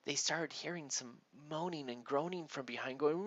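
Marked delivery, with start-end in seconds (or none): none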